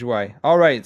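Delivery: normal talking voice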